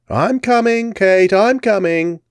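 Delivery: high, slow English lady calling back; English accent. Acted. Englishwoman calling back to Kate in English accent. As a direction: answer in a refined English accent. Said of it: "a voice answered in English accents".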